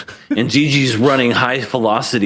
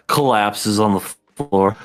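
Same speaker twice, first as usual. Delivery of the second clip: normal talking voice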